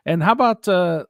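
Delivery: ordinary delivery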